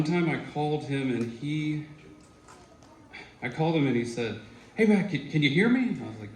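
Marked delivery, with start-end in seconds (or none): 4.7-5.9 high, loud mock teacher on the phone; phone call greeting. Teacher calling his old student, higher and louder. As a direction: call out brightly like he's on a bad line.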